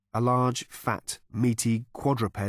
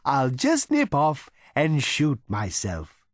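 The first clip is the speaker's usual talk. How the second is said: higher, slow, cheerful cow voice; cow character; 5 semitones higher, 4 dB louder, slower than usual. A narrator's reading. Cow taking leave of diners, in a higher, slow cheerful voice. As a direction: cheerfully excuse yourself.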